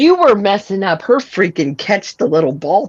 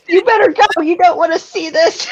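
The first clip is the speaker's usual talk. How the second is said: lightly voiced high mock warning; a playful, high menacing warning; high pitched mock threat to the nosy driver; 12 semitones higher than usual. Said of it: "That or yelled".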